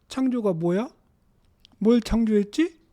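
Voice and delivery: a hint of a child asking what words mean; childlike questioning. Shown as a demonstration. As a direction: puzzle it out aloud with rising questions. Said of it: "물어보는 것처럼".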